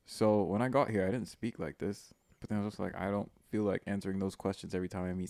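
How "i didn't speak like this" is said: ordinary talk, nothing marked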